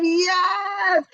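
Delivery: high, loud woo-hoo of joy; real excited shout; excited whoop. Real, not acted. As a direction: cheer it out, thrilled. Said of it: "I'm excited".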